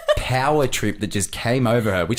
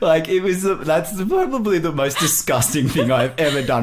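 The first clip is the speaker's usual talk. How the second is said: higher voice cracking up; real laughing; 7 semitones higher, 3 dB louder than usual. Real, not acted. Boyfriend confessing his prank to girlfriend, laughing hard. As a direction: tell it through helpless laughter.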